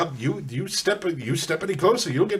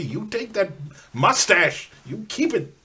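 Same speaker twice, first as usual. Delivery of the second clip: usual speaking voice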